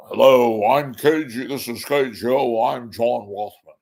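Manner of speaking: mildly put-on talk show host greeting; John Rothman impression. Host imitating John Rothman to his listeners, lightly voiced. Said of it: "host a show with John Rothman".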